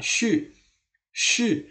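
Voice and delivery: "shi" very high and sharply falling; fourth-tone shi. Shown as a demonstration. Teacher shows that shi is fourth tone, high fall. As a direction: drop each "shi" from high pitch. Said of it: "Ini kan nada keempat".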